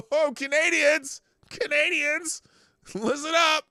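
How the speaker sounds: high, loud alarmed call. Pretend. Host calling on Canadians to listen, loud high shout.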